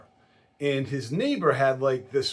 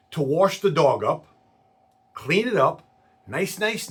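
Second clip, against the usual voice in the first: ordinary talk, nothing marked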